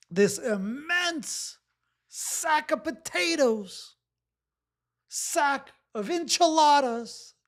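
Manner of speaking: high, drawn-out mock-grand voice; mock-grand silly voice. Coach joking about a client's pile of burdens, high mock-grand voice. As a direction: play it up like a silly joke.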